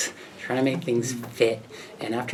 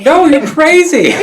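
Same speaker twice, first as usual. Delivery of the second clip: high loud slow scolding; wife rejects her husband's news, high, loud and slow; exasperated no; 15 dB louder, slower than usual. Retold or imitated. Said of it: "She's like".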